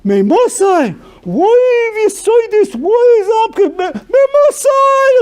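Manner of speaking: very high, loud, slow excited shouting; enthusiastic learner. Retold or imitated. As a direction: shout it out with new-found thrill. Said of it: "He'll kick the Chaim".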